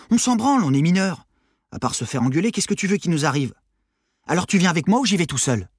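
high, loud dismissive comeback; defiant teenage Armand. Acted. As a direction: brush off the objection and challenge her. Armand brushes off objection, gives ultimatum. (ado effronté qui lance un défi)

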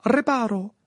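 a high, crisp incantation; spell cast. A narrator's reading. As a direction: snap the spell out.

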